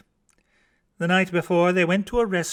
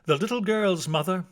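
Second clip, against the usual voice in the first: ordinary delivery, nothing marked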